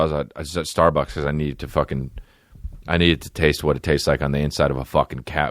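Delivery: ordinary delivery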